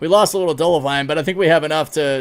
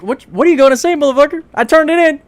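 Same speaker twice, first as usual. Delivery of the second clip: loud, high, indignant comeback; mock angry retort; 11 semitones higher, 9 dB louder than usual. Streamer mocks the NPC who sounded mad, loud indignant retort. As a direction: fire back like you're daring him to yell. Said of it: "I'm like".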